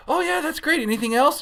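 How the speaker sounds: high-pitched, chirpy agreement; peppy, over-the-top service voice; happy drive-through worker. Acted. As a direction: take the order with bubbly cheer. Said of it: "like, a happy person".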